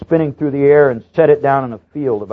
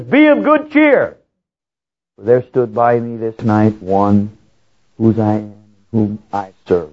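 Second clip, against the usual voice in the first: Paul's slow, raised proclamation; 2 semitones lower, slower than usual. Retold or imitated. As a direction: proclaim it slowly to the frightened crew. Paul stands up and says.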